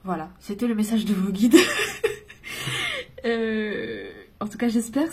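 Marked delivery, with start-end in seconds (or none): 1.6-3.2 very high burst of laughter. Real, not acted. Reader laughing after channeling the guides. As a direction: laugh with relief.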